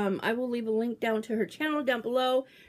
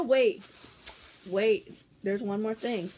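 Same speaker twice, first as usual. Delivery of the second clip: normal talking voice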